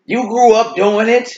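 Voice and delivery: high, loud mock protest; mimicked objector. Mimicking critics of skipping Christmas.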